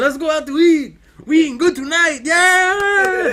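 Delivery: hammed-up Mexican whoop with a drawn-out end. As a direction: holler it in a caricature Mexican accent. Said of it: "my Mexican is the worst".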